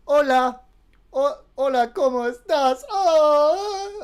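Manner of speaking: high, loud hyped greeting in Spanish; Spanish meme voice. Pretend. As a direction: hype it up like the meme. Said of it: "but with Spanish version".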